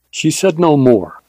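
ordinary delivery

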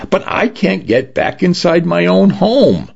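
high-pitched, pleading protest; frustrated. A narrator's reading. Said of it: "Dinah bit back her frustration".

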